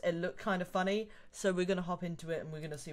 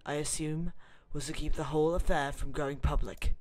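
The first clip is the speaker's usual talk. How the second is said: dramatic narration; 3 semitones lower, 5 dB louder than usual. Acted. Gamer narrating the intro as a dramatic story. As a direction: read it like a movie trailer intro.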